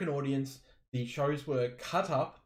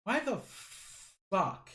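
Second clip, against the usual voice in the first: incredulous exclamation; 7 semitones higher than usual. Retold or imitated. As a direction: stretch each part out, pitched up in disbelief. Voicing an incredulous thought.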